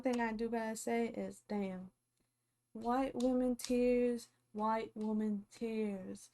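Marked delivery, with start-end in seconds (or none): 2.7-6.3 mocking catchphrase.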